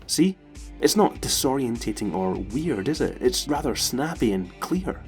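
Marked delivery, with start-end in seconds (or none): none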